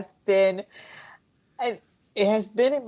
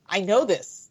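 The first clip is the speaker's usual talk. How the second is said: usual speaking voice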